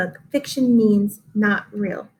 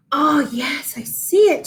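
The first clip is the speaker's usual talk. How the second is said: mooing excitedly; 3 semitones higher, 3 dB louder than usual. A narrator's reading. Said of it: "she mooed excitedly".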